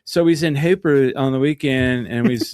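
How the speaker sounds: country West Texas weekend chatter; West Texas accent. Shown as a demonstration. Showing friends the accent back in full, West Texas accent. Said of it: "it pops me back into it".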